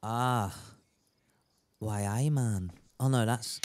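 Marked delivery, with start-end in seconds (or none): 1.8-2.7 low, soft Geordie accent; Newcastle accent. Shown as a demonstration. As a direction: slip by mistake into a Geordie accent. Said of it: "that's, uh, Newcastle".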